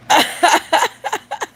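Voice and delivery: high, rapid cackle; high laughter. Real, not acted. Joker pleased with a good one, high laughter.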